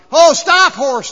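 loud, high yell at a runaway horse; panicked yelling. Acted. Rider on a horse that doesn't stop, panicked yelling. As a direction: holler desperately for the horse to stop. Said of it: "he starts yelling".

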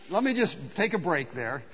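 ordinary delivery

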